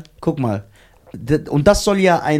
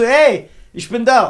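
acting cool; 7 semitones higher, 5 dB louder, slower than usual. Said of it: "ich will so auf cool".

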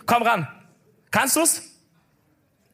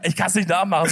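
normal talking voice

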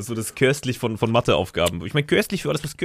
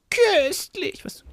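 very high, drawn-out squeal of köstlich; Salim voice; much slower than usual. Retold or imitated. Photographer quoting Salim's catchword under a message, high Salim voice. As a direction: stretch köstlich out in a high put-on voice. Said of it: "Salim Voice".

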